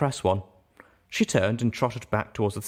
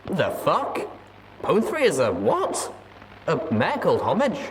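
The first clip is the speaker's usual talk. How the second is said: higher, disbelieving outburst; real surprise; 7 semitones higher than usual. Real, not acted. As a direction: caught off guard mid-reading. Reader reacts to the story twist.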